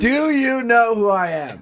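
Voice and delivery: high, slow, pompous demand; mock self-important. Host to caller, hammed-up pompous demand. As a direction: play the offended celebrity.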